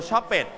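"shop it" with ED as a full extra syllable; mispronounced "shop it", ending sounded as "pet"; wrong ending. Shown as a demonstration. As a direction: say "shop it" in two beats, like "shop-pet". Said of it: "we don't pronounce shop it".

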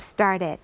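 "started" with -ed sounded as its own syllable; extra -ed syllable. Shown as a demonstration. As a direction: say "started" as star-ted. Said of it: "เพิ่มอีกหนึ่งพยางค์".

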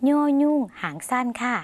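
normal talking voice

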